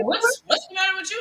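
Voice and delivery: high, puzzled partner voice; imitating the partner. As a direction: voice him asking what's wrong. Partner's retold concerned question.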